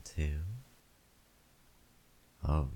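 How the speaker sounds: ordinary delivery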